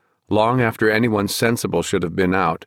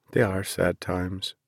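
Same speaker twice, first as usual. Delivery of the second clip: sad; 7 dB quieter, slower than usual. Acted. Son agreeing quietly, sadly.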